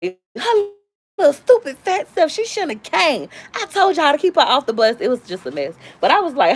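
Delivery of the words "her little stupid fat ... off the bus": mean big sister. Retold or imitated. Sister scolding in retold story.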